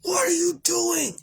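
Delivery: high crying scream; desperate mother's scream at her daughter. Retold or imitated. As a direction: shriek it out through tears. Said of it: "I screamed and yelled back".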